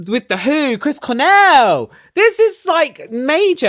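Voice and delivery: high, loud, gushing wannabe squeal; gushing excitement. Real, not acted. Vlogger gushing to viewers about wanting the groupie life, high excited squeal. Gushing over band names.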